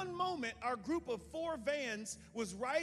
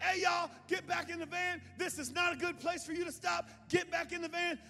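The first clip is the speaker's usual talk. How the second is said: loud, high call to get back; shouting; 10 semitones higher, 5 dB louder than usual. Retold or imitated. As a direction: yell across the lot. Shouting to group.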